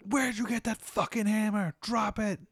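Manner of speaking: high, barked guard order. Acted. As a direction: yell at the intruder to drop it. Guards order hammer dropped.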